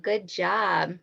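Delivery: usual speaking voice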